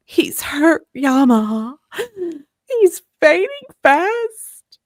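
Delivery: high, loud, tearful plea; voice breaking. Acted. Sam telling Yamaha Frank is fading, voice breaking. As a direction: cry it out, cracking with distress. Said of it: "his voice breaking".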